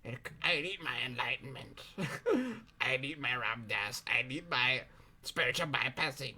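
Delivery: soft, low, mock-whiny inner voice; mock the part of you clinging to enlightenment. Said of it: "a part of you that says".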